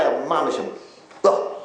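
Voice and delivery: normal talking voice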